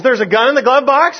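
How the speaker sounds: high, startled question; officer can't believe his ears; a startled cop asking again; shocked officer. Acted. Officer hears of a gun.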